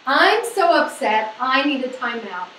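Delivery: "I'm" slowed and leaned on; stress on I'm. Shown as a demonstration. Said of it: "What does it start with?".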